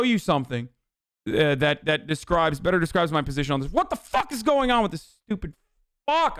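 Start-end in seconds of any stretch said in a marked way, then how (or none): 3.7-6.4 exasperated outburst, pitch jumping up; real frustration. Real, not acted. Streamer fighting a broken camera mid-stream, exasperated outburst. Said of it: "I'm getting angry".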